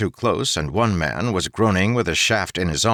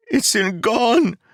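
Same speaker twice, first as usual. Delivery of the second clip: put-on high, slow, broken-hearted young man's wail; groaning Keeg; 4 dB louder, slower than usual.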